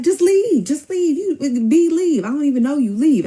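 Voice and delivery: high, loud, agitated shooing; retold frantic dismissal. Man ordering the girl out of the house, frantic. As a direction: imitate him desperately getting rid of her, loudly.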